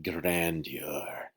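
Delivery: "grandeur" drawn out slowly and softly; funky grandeur. Shown as a demonstration. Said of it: "You gotta say that funky".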